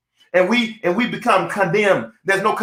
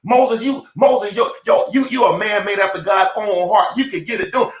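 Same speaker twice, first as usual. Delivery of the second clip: usual speaking voice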